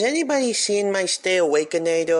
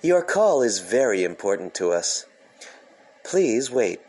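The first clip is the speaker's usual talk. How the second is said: lower, hold recording voice; deep phone hold message; 9 semitones lower than usual. Acted.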